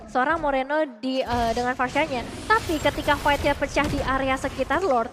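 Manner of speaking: usual speaking voice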